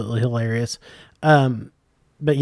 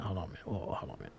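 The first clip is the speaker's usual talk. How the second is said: whispered; 7 semitones lower, 24 dB quieter, much faster than usual. Real, not acted. Trailing off, stopping the story.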